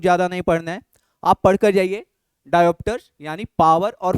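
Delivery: loud, pressing instructions; emphatic teacher. Real, not acted. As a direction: hammer the list home loudly. Teacher insisting on topics.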